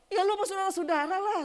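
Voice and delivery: fast, high, loud comeback; emphatic retort. Retold or imitated. Preacher quoting his reply to a relative, emphatic retort. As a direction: fire back an exasperated-sounding answer.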